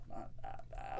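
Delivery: a quick whispered aside; whispered reassurance. Real, not acted. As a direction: breathe it out, no voice.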